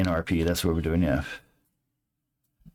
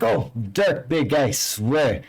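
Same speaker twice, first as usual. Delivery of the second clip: slow, higher, flustered placating; backing off nervously from a big guy; a touch of startled pleading, slow; startled plea; 8 semitones higher, 4 dB louder, slower than usual. Acted. Startled NPC jumps back.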